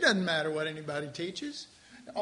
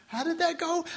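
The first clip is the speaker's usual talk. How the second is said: higher-pitched puzzled self-quote; mock bewilderment; 8 semitones higher than usual. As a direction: act out losing the thread. Retelling own confusion at vanished insight.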